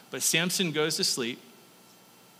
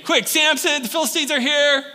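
high, loud warning cry; alarmed shout; 10 semitones higher, 5 dB louder than usual. Acted. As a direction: call out in staged panic. Third alarm to Samson.

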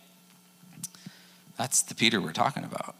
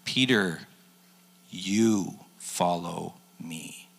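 slow, soft, lower command; gentle Jesus; 4 semitones lower, 4 dB quieter, much slower than usual. Retold or imitated. Jesus answering Peter, slow and soft. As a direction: lay it down gently and slowly.

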